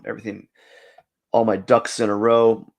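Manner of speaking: usual speaking voice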